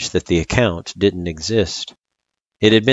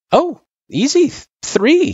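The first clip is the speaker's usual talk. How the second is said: put-on high, louder child voice; confident kid; 5 dB louder than usual. Frisk answering her tutor, confident kid. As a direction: pipe up with the easy answer.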